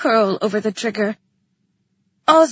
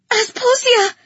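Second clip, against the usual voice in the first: high, loud call for help; urgent shout; 11 semitones higher, 6 dB louder than usual. A narrator's reading. Someone below decks shouts for Asposia, urgent shout. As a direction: shout the name in alarm. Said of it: "someone shouted".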